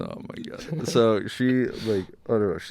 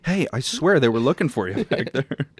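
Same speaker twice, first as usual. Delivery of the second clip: mock helpful stranger; 6 semitones higher, 6 dB louder than usual.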